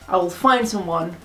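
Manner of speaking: normal talking voice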